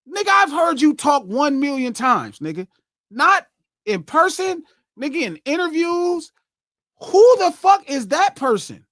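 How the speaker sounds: high-pitched incredulous rant; exasperated disbelief. Retold or imitated. Exasperated at [name]'s unfamiliar new accent.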